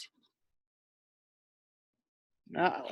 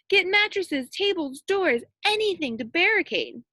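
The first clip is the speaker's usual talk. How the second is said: urgent orders; 6 semitones higher than usual. A narrator's reading. Mayor orders the townspeople to barricade, urgent commanding call.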